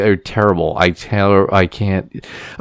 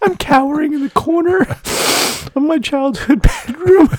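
teary. Real, not acted. Still teary after crying.

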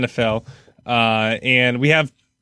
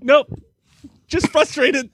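strained, much higher voice; higher mock reassurance; 11 semitones higher, slower than usual. Father to son, higher strained reassurance. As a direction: pitch it way up, forced calm. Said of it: "I just had to be like".

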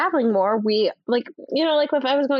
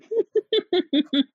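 high, shrieking laugh from afar; screaming laugh; 8 semitones higher than usual. Real, not acted. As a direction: squeal with laughter off-mic. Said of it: "her screaming laughs".

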